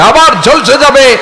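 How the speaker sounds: high, loud, stretched warning; emphatic preaching cry. Real, not acted. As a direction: cry it out to the congregation, slowly. Preacher dramatising repeated burning.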